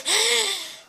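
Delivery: high gasping for air; breathless gasp. Real, not acted. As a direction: gasp to recover from a scare. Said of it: "I'm out of breath".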